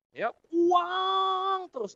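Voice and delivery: high, very drawn-out mocking wail; mock whine. Speaker mocking people who chase only money, drawn-out wail. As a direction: stretch it into a mocking cry.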